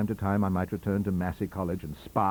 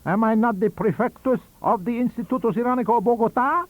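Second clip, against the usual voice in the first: higher, louder boastful professor; lofty old scholar announcing his title; proud professor; 12 semitones higher, 8 dB louder than usual. Acted. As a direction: puff up as the grand prefectus. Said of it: "said he".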